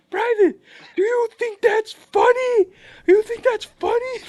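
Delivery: very high, full-volume imitated bellow; drill sergeant yell. Drill sergeant confronting the private who laughed, yelling. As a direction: roar it like an outraged drill sergeant.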